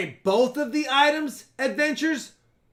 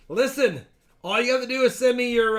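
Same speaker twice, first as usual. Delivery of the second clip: normal talking voice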